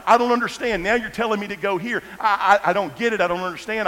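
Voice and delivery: high, loud, exasperated cry to God; pleading in confusion, voice up high; a prophet's baffled loud complaint; bewildered plea. Acted. Voicing Elijah's bewildered complaint to God.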